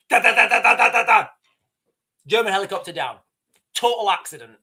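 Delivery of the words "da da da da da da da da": rapid, loud "da-da-da" gun burst; imitated machine-gun fire. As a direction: rattle it off like a gun firing.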